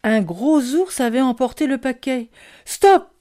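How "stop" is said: high, loud sudden shout; cried out. A narrator's reading. Child crying stop at the bear with the parcel, shouted. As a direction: shout it to halt a thief. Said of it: "ai-je crié".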